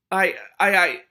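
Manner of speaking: high, loud broken stammer; stuttering. A narrator's reading. Frightened rat catcher trying to confess, stuttering. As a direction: stumble on the first word. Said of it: "the man stuttered".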